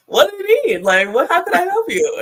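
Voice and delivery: eager husband. Retold or imitated. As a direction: jump at the chance to help her. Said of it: "He's like".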